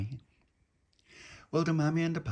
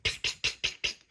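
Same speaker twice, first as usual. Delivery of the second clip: voiceless tick tick door knocks; imitated knock. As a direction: tap out the frantic knock with your mouth. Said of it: "a frantic knock on the front door".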